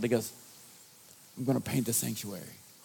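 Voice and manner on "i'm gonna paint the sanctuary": softer, quick New York guy impression; lightly voiced, offhand announcement, softly; imitating Vince. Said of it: "he talked just like this".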